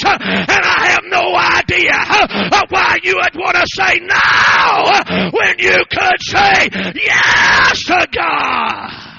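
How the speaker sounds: loud, driving preacher cadence; hoarse-free fiery sermon shout; preaching at full force. Acted. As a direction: press the altar call hard.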